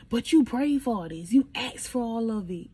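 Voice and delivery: forceful, exasperated reproof; mother's scolding. Retold or imitated. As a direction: imitate a mom setting her child straight. Imitating mother scolding her.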